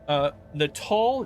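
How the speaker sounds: usual speaking voice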